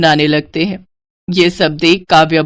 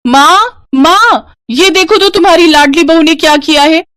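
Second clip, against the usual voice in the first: higher, louder angry cry to her mother; angry shout; 8 semitones higher, 4 dB louder than usual. Acted. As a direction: shout for mom in outrage. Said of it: "गुस्से में चिल्लाते हुए कहती है". (गुस्से में चिल्लाना)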